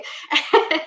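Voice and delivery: short breathy laugh; light laugh. Real, not acted. Laugh after the callback joke.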